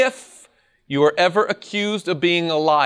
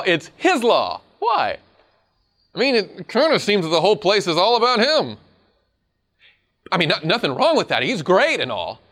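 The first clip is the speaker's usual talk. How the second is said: ordinary talk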